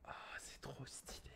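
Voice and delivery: very soft, whispered delight; awed whisper. Real, not acted. Streamer first seeing the camera image, whispering in delight. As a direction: whisper it as if quietly amazed.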